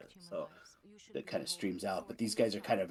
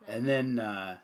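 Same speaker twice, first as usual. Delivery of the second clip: ordinary talk, nothing marked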